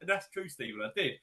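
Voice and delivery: ordinary talk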